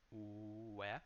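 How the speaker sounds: where with W like a U sound; careful where. Shown as a demonstration. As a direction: start where with a rounded oo-like W. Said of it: "the W sounds like a U".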